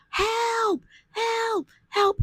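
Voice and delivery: high, thin repeated calls for help; begging the boats to notice her; desperate cries. Retold or imitated. Drowning woman calls to passing boats, high thin cries.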